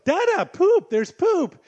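dad mimicking his son's delighted poop alert; excited toddler voice. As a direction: squeal it like a thrilled two-year-old. Quoting toddler spotting poop.

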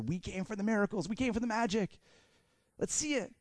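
high, hushed clamour; mock excited onlooker, kept soft; eager crowd member. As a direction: climb in pitch as the demand builds. Acted crowd demanding miracles.

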